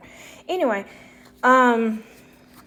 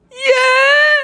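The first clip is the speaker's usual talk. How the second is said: very high, loud, drawn-out cry; hammed-up tearful squeal; mock wail; 14 dB louder than usual. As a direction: imitate an overwhelmed wife bawling yes. Imitates tearful wife wailing her answer.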